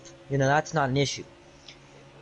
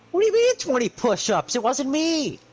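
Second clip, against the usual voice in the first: indignant protest; 4 semitones higher than usual. Retold or imitated. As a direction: protest your innocence hard. Protesting punishment to teacher.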